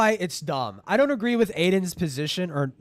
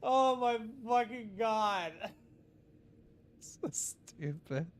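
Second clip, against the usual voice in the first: laughing; 6 semitones higher, 6 dB quieter, slower than usual. Real, not acted. Giggling at clip.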